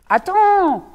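sharp loud call; loud stop. Acted. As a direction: cut him off loudly. Rabbit stops the wolf. (lapin qui crie d'attendre)

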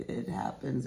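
ordinary delivery

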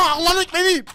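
laughing, high and loud. Real, not acted. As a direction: laugh it out triumphantly. Laughing after a kill.

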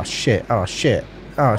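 normal talking voice

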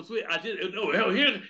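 ordinary talk, nothing marked

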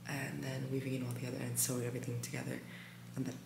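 very soft, low late-night voice; hushed. Real, not acted. Telling viewers the sewing plan at sleepy time, hushed. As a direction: murmur the plan, barely above a whisper. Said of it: "whispering because it's sleepy time".

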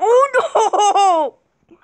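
loud high laughing cry. Real, not acted. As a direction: yelp it, half laughing. Sudden loss in game.

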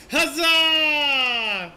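loud triumphant war cry; battle cry. Real, not acted. Gamer roaring a battle cry at the boss. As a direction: bellow it like a knight charging in.

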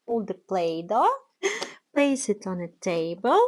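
usual speaking voice

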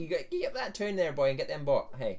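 loud, hammed-up rural Northern Irish farmer calling out; mock Northern Irish accent. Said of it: "She'll be talking like that soon".

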